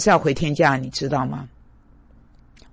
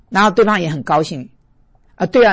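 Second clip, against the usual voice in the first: usual speaking voice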